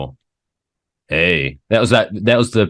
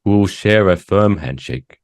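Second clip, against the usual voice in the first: higher, slow, genteel courting voice; prim polite suitor; 5 semitones higher, 4 dB louder than usual. Pretend. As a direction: play an absurdly proper gentleman on a date. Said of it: "one wants to slide into someone's D M".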